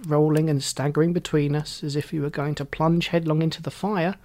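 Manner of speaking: ordinary delivery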